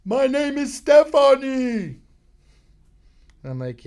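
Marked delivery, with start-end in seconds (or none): 0.1-2.0 very high, very loud, slow shouted woman's voice; woman at loud bar. Retold or imitated. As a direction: shout her name slowly over the noise. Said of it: "And she's like".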